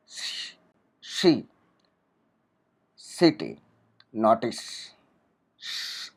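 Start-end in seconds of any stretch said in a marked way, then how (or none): 5.6-6.1 a long unvoiced hiss of श; sh sound demo. Shown as a demonstration. Teacher showing students a voiceless sound, a long श hiss. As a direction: push air out in a steady श. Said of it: "ऐसा sound आता है".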